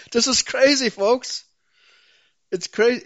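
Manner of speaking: real agitation, voice pitched high; hot under the collar. Real, not acted. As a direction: exclaim it in heated exasperation. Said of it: "I'm hot under the collar".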